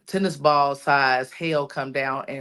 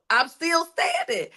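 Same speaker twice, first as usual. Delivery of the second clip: much higher-pitched, insistent repeat; emphatic declaration; 13 semitones higher than usual. Acted. Telling yourself in the mirror you survived, emphatic declaration. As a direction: drive home STANDING on a high pitch.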